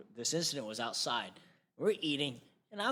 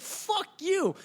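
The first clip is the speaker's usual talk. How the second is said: much higher, drawn-out mock yell; retold yelling; 3 dB louder than usual. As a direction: repeat the curse with more force and a high pitch. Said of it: "I'm, like, yelling at him".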